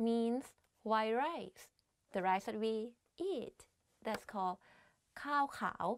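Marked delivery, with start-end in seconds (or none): 5.2-5.9 ข้าวขาว with falling tone on ข้าว then rising tone on ขาว; Thai tones. Shown as a demonstration. (ข้าว falling tone, ขาว rising tone)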